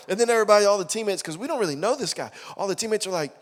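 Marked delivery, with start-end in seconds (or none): none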